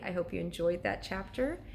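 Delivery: ordinary delivery, nothing marked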